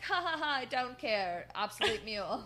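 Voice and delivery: loud mock villain laugh, then dismissive; hammed-up wrestling villain, loud and scornful; imitating Matt. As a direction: cackle theatrically and brush them off. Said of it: "And then Matt's like".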